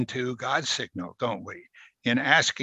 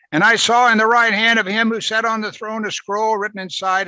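raised, higher declaiming read; higher, louder reading of Revelation; scripture reading; 8 semitones higher, 5 dB louder than usual. Acted. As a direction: proclaim the vision aloud.

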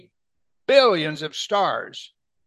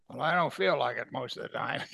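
mock discouraged voice; 3 semitones lower, 8 dB quieter than usual. Voicing a discouraged believer.

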